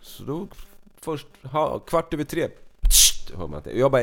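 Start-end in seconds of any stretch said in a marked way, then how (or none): 2.8-3.3 short, sharp "pssst" hiss; can-opening hiss. Retold or imitated. Storyteller imitating a can opened at quarter past three, sharp hiss. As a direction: imitate a drink can cracking open.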